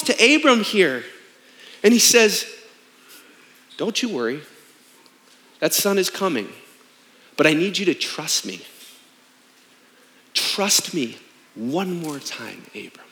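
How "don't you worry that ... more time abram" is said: gently promise, trust me; a lightly voiced God, tender and low; reassuring God.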